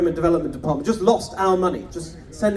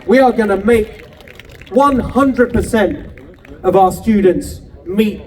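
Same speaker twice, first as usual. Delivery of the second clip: louder, punchy campaign oratory; rousing rally speech; 6 dB louder than usual. Acted. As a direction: drive every promise home to the crowd. Rally speech climax at full force.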